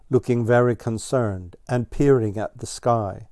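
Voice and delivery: plain reading voice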